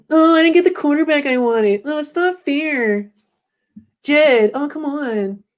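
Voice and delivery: very high, whiny pop-star falsetto. Acted. Host voices coach denied his quarterback, whining high falsetto. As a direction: plead in a drawn-out falsetto whine. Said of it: "that's my [name] impression".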